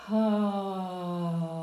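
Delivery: a drawn-out breathy "ha."; long breathy ha. Shown as a demonstration. Coach repeating the exercise, long breathy "ha.". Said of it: "and out on".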